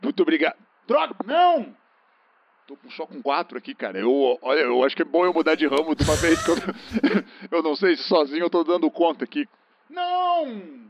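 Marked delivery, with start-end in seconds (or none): 0.9-1.7 anguished cry. Acted. Another hostage escapes. 9.9-10.8 anguished cry. Acted. Couple escapes.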